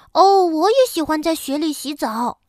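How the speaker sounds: eager little bear. A narrator's reading. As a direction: burst in like an eager child.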